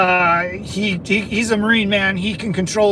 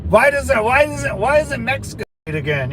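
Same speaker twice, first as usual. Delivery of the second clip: mock outrage; 5 semitones higher than usual.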